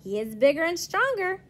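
plain reading voice

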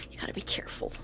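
breathy hushed repeat; whisper. Acted. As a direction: whisper the warning again.

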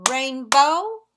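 "rainbow" in two loud beats, rising at the end; "rainbow" split in two, second beat lifting; syllable by syllable. Shown as a demonstration. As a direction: clap out both syllables of "rainbow". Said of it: "as we say them, let's clap".

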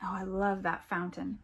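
plain reading voice

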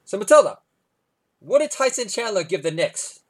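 put-on bouncy parent quizzing a child; cheerful dad prompt. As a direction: set up the punchline for your kid. Dad prompting toddler, voiced.

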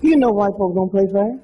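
normal talking voice

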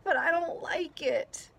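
put-on a little girl upset in the storm; upset child. A narrator's reading. Stina to grandpa on the rock, tearful and high. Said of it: "she said".

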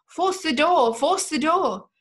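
high, forceful shouted command; terrific cry. A narrator's reading. Officer ordering ruffians to break in, loud commanding cry. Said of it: "A voice, terrific in the extreme, cried out".

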